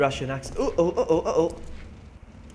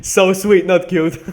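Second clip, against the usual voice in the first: sweet and not punched loud and high; loud correction; 7 semitones higher, 14 dB louder, slower than usual. Shown as a demonstration. Correcting chat loudly, stress on sweet and not. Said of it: "Uh, correction".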